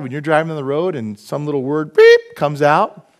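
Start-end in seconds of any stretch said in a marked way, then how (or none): 1.9-2.2 loud, slowed bleep sound; mock censor beep. Dad bleeping his own swear word on the road, loud slow beep.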